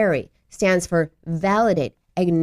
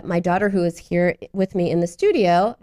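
ordinary talk

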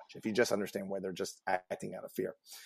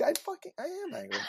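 squeaky mock fury, much higher; mock angry outburst. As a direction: ham up a friend's angry outburst, pitch way up. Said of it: "it empowered you to be like".

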